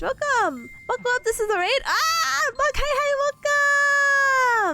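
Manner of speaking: high, drawn-out hello; excited greeting. Real, not acted. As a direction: welcome the new raiders warmly. Greeting more raiders.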